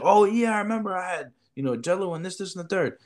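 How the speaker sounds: high, eager fake-remembering kid; mock kid voice. Kid voiced much higher.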